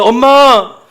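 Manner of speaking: put-on loud high wail of a child; child's loud crying call; child calling mom. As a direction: cry out for mom coming home in tears. Crying child comes home.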